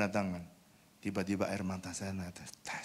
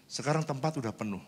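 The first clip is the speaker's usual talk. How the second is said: ordinary talk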